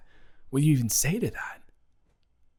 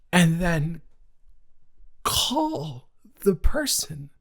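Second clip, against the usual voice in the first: higher, slow, incredulous outrage; rising indignation; 5 semitones higher, slower than usual. Real, not acted. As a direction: stretch it out in disbelief. Building outrage at cheater.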